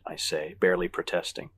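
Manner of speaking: ordinary delivery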